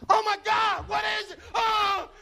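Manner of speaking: high, loud shocked cry; startled onlookers. Retold or imitated. People on the street at the coffin, shouting in shock. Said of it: "they're going".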